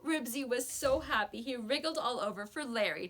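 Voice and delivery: ordinary delivery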